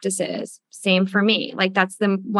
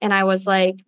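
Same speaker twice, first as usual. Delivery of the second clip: ordinary talk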